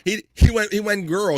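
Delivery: high, loud, amazed telling; excited. Real, not acted. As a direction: blurt it out in delight. Excited retelling of the prank.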